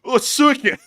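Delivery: put-on high, loud Russian-accented curse; mock Russian exclamation. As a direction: yelp it like a startled Russian soldier. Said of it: "and he's like".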